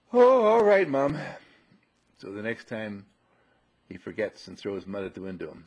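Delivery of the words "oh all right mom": high, loud groan of surrender, falling off; acted reluctant agreement; grudging little boy. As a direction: give in like a put-out kid. Voicing boy giving in reluctantly.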